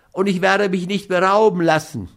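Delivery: high, plaintive character voice; whiny dog owner, protesting. A narrator's reading. Schmidt takes the money under protest, whiny. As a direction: complain in a high voice while giving in.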